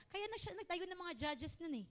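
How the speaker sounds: ordinary delivery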